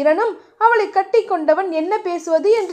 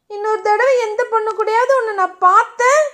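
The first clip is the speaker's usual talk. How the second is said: higher, mock stern warning; threatening; 7 semitones higher than usual. A narrator's reading. Diya warns Dheeran off other girls, mock threatening. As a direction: playfully threaten him. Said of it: "Diya மிரட்டவும்".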